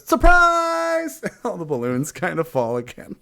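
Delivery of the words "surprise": high, loud surprise yell; party shout. Acted. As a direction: shout it as the crowd.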